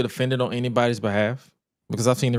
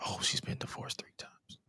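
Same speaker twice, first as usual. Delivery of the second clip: breathy, much softer retold reaction; hushed realization; 17 dB quieter than usual. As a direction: trail into a near-whisper. Retelling a shocked discovery in hush.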